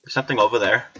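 ordinary talk

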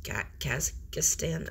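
Kazakhstan sounded out slowly in halting pieces, Ka- then Kazakhstan; slow halting tries at Kazakhstan; stumbling pronunciation. Real, not acted. Vlogger unsure of a place name, sounds out Kazakhstan slowly. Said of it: "I don't know what that name is".

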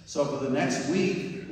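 ordinary delivery

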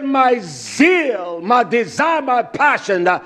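preacher reciting scripture with fire; impassioned scripture reading. Acted. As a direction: cry out the verse with passion.

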